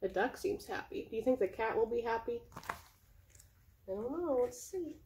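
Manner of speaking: ordinary delivery, nothing marked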